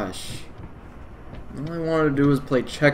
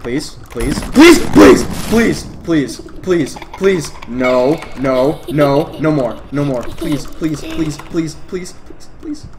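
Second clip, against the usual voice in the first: frantic begging; 8 dB louder than usual. Real, not acted. Begging in fear.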